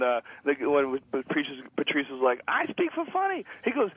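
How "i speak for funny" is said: high-pitched mocking quote; mock declaration. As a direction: pitch it way up to imitate the claim. Quoting a claim in a retold debate.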